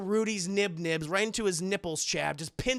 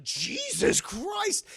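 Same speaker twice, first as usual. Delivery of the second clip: high, slow startled cry; mock alarm; 12 semitones higher, much slower than usual. Michael Myers overwhelmed by the knives, mock startled cry. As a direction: yelp it like a cornered old man. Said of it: "Michael's like".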